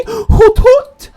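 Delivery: high loud owl hoot. Acted. Player voicing the owl character, high hooting.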